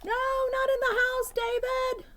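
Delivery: high, loud stern mother calling out; scolding mom. Acted. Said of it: "David's mom always said".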